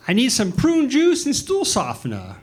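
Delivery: put-on high, thin voice of a ninety-year-old mom; old mother voice. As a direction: voice the elderly mother asking for groceries. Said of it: "She goes".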